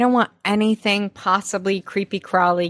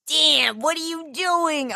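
high-pitched mock mentor voice; mentor impression; 8 semitones higher than usual. Mentor calling out Dan, exaggerated high voice. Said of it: "It was the mentor".

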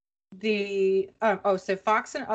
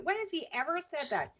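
ordinary talk, nothing marked

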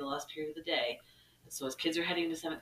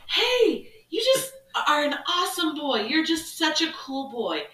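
much higher and louder, sing-song; calling out cheerfully; 11 semitones higher, 8 dB louder than usual. Retold or imitated. Teacher calling to a passing student, loud and cheery. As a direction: call out friendly praise.

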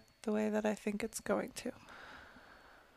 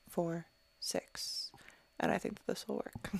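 whispering; 5 semitones lower, 9 dB quieter than usual. Real, not acted. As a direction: breathe it under your breath. Whispers while giving the number.

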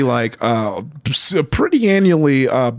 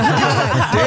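laughing; 10 semitones higher than usual. Retold or imitated. Retold reaction, laughing.

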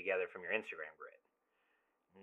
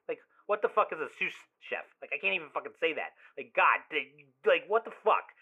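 worked up, pitch jumping up on each "what the fuck"; exasperated rant; 4 semitones higher than usual. Acted. Friend ranting about the ex's new sous chef boyfriend, exasperated. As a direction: sputter in disbelief.

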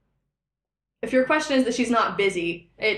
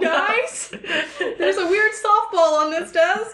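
puzzled coworker voice; 8 semitones higher, 3 dB louder, slower than usual. Acted. Ranger voicing a puzzled coworker.

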